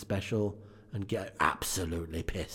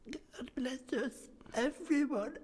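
put-on very high, breathy little boy; tiny child whisper; 5 dB quieter, slower than usual. Tiny Tim voiced.

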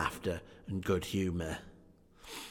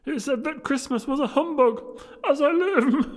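very high, loud hammed-up nephew; crying out mirthfully; 9 dB louder than usual. Nephew telling friends about Scrooge's humbug, high loud cry. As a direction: cry it out at the top of the voice. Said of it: "cried Scrooge's nephew".

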